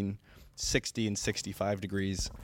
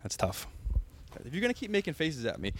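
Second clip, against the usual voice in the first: ordinary talk, nothing marked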